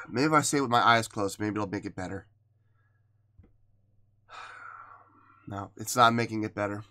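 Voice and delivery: soft, low, sighing; really choked up, voice dropping away; holding back sobs. Real, not acted. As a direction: try to get it out without breaking down. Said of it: "without just, boom, just, you know, sobbing".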